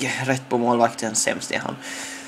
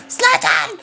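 high call. Retold or imitated. Crowd calls his name.

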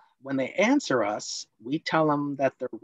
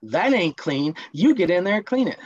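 higher, louder, fast scold; scolding parent; 5 semitones higher, 4 dB louder than usual. Acted. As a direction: order the kid back to work. Role-play critical parent scolding child.

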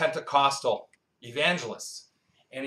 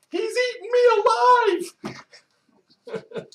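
squeaky, much higher, screaming prey voice; panicked antelope; 9 dB louder than usual. Retold or imitated. Preacher voicing an antelope in a sermon joke, high panicked shriek. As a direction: shriek it like a cartoon antelope being eaten. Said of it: "the antelope's sitting there going".

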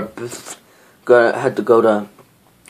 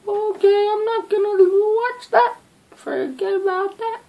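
much higher, squeaky frightened voice; high scared squeal; high squeak. Real, not acted. Frightened by the video.

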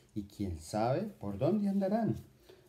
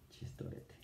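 whisper; 16 dB quieter than usual. Acted. Puppet voice whispering.

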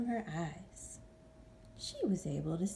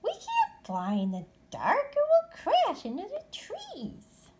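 put-on worried young bat; 4 dB louder than usual. Stellaluna worried.

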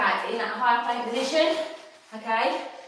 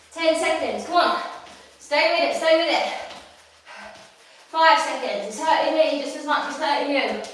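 higher, louder urging to hold on; loud encouragement; 4 semitones higher, 5 dB louder than usual. Real, not acted. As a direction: push them through the last seconds. Final push of the round.

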